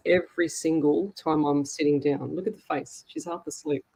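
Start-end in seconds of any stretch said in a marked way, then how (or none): none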